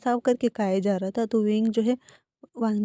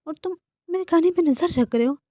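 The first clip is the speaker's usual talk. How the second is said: indignant; 7 semitones higher, 6 dB louder than usual. Acted. Wing snaps back at Wangji.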